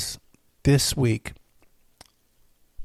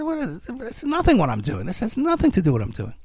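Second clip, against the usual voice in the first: incredulous retort; 7 semitones higher than usual. Retold or imitated. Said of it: "And I said".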